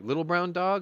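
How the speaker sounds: asking it high and loud, hoping it's Charlie; surprised question. Retold or imitated. To the UPS driver about the lost dog, a high surprised question.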